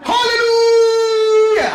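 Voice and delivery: long, high, very loud hallelujah; drawn-out shouted hallelujah. Shown as a demonstration. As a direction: belt it out to prove the point. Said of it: "Suaramu yang harus keras".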